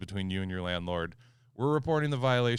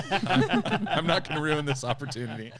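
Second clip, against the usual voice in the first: laughing; 6 semitones higher, 7 dB louder than usual. Real, not acted. Laughing while retelling his reply.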